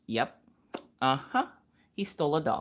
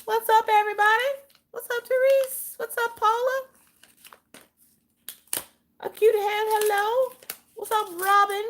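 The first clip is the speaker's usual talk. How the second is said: much higher bright greeting to each chatter; high sing-song greetings. Acted. Host greeting her live chat in a high sing-song voice.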